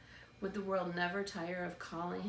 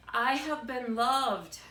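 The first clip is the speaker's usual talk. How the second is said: defiant; 4 semitones higher, 9 dB louder, slower than usual. A narrator's reading. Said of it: "Edward told the stars".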